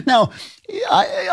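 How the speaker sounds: ordinary talk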